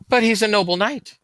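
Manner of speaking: put-on high, loud boyish protest; a boy defending his master in earnest; young boy protesting. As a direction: naive boy insisting his lord is noble. Boy protests about his lord.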